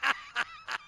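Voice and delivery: startled yelp. Acted. Bob's astonished cry.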